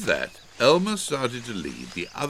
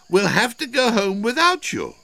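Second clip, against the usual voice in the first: loud, high, carrying voice; raised voice, high and firm; calling out a warning; 6 dB louder than usual. A narrator's reading. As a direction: call up to someone out of sight. Calling a warning to hidden cousin.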